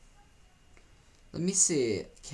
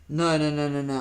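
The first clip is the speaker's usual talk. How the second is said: despairing no; 2 semitones higher, 9 dB louder than usual. Real, not acted. Reacting to lost feature.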